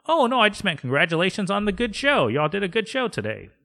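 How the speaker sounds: high, quick backpedalling voice; Noel. Acted. Eager coworker explains his congratulations, high and fast. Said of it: "And he's all".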